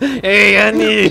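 hammed-up, stretched greeting; Watto voice. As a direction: call out like an alien trader. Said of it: "It's Luke walking in".

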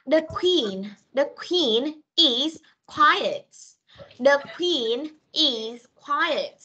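each word drawn out slowly for pupils; slow model reading. Shown as a demonstration. Teacher reading to young pupils, very slow and careful.

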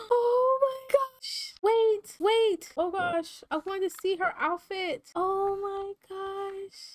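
very high, overcome squeal; gushing squeal. Real, not acted. Player seeing her sim's graduation outfit, gushing squeal.

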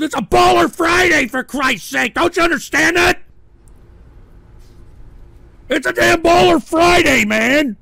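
high, loud indignant yelling; exasperated shout. Real, not acted. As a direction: protest at the top of your voice. Fed up with trolls.

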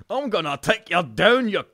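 mock battle threat. Improvising a mock fight.

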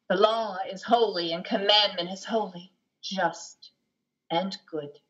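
slow, weighty recitation of a confession; solemn narrator reading. Acted. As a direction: lean on each phrase like scripture. We hear the narrator.

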